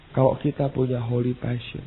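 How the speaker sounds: ordinary delivery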